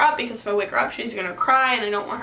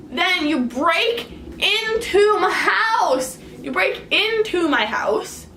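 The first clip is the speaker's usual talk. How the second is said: hammering it home, pitched up; retold exasperated lecture; 3 semitones higher than usual. Lecturing boyfriend, retold anger.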